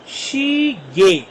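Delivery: slow "शी गे", ending in a simple "गे"; correct silent glide. Shown as a demonstration. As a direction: stretch "शी गे" with one syllable in "गे". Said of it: "इसको गये नहीं पढ़ना". ("शी गे" धीरे, "गे" एक ही अक्षर में)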